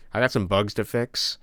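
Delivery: normal talking voice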